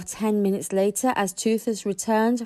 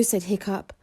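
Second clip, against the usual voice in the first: ordinary delivery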